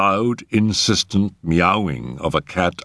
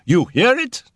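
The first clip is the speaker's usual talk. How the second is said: high, raised urgent repeat; alarmed cry; 4 dB louder than usual. A narrator's reading. Settle urging Carstairs to listen, high alarmed cry. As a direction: press the question in a very high voice. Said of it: "he cried".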